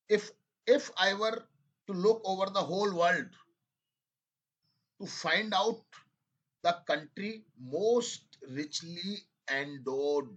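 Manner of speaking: each phrase read out very slowly, with long pauses; slow dictation reading. Shown as a demonstration. Teacher dictating an English passage to students, slow and deliberate. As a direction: dictate it word by word for students to write. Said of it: "I am reading it again, second time".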